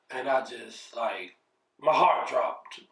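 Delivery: ordinary talk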